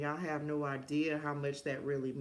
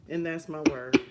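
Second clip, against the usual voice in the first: louder, firm final word; loud emphatic declaration; 8 dB louder than usual. Shown as a demonstration. Closing the message to viewers, loud and emphatic. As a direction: stamp it down hard.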